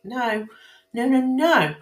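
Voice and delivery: normal talking voice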